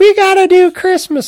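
high mocking imitation of her; mocking girlfriend voice. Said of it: "She's like".

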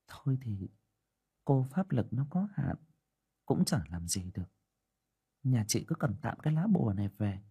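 the sorceress admitting she cannot help; resigned fortune teller. Acted.

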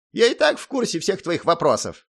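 high little gnome voice; huffy gnome. A narrator's reading. Gnome answering the hero, huffy. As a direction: tell them you already know, huffily.

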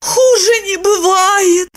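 high, slow miserable woman; suffering Lipochka. A narrator's reading. Woman admits her hangover is awful, high miserable voice. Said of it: "призналась Липочка".

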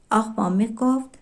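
plain reading voice

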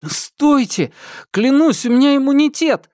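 high, loud, panicked plea; panicked shout. Acted. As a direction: cry out in panic. Thomas cries out at gunpoint.

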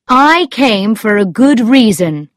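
earnest man's dialogue, read aloud; Lord Struve speaking. A narrator's reading. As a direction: voice him emphatically in storytelling.

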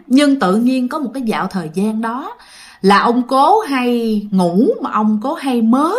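ordinary delivery

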